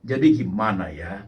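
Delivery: a hint of a worried person muttering to himself; low, musing question to oneself; lightly voiced self-talk. Preacher imitates a stressed person talking to himself, low and musing. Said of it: "Ngomongnya begini".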